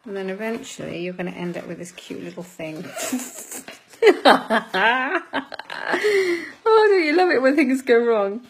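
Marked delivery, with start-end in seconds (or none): 2.9-8.4 exasperated laugh, loud and high; laughing at failure. Real, not acted. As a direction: laugh it off as it falls apart. Craft keeps failing.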